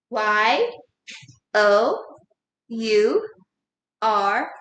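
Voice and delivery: letter by letter, a pause after each; spelling out. Shown as a demonstration. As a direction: spell Y, O, U, R one at a time. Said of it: "spell the word your in the air".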